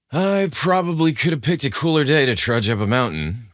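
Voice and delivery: lightly voiced Jason, tired of the heat; sighing. Jason complaining to Luke about the hike, mildly weary. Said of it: "Jason sighed".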